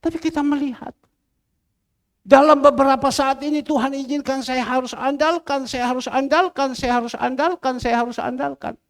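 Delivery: high, insistent proclamation; forceful preaching. Real, not acted. Preacher testifying he must rely on God, forceful. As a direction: hammer out the repeated phrase.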